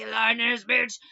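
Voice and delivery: drawled southern hillbilly man saying "A lying-ass bitch"; mock hillbilly man voice. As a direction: growl it like a rough country guy. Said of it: "hillbilly like that".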